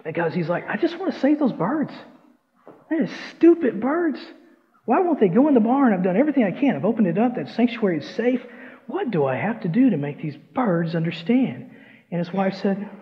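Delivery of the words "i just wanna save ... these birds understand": high-pitched exasperated farmer; acted frustration, pitched up and pleading for answers; frustrated. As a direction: plead at your wits' end, voice raised high. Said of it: "he's getting frustrated".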